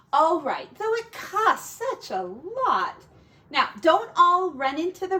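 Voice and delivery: lightly voiced anxious mother; mother pig voice. Mother pig speaking to piglets.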